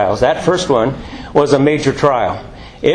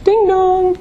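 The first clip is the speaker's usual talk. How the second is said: doorbell chime. Acted. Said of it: "The moment you think you have it".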